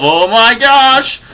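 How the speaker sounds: very high, loud, drawn-out cry of amazement; awestruck exclamation. Pretend. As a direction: gush it slowly at full volume. World map reveals itself.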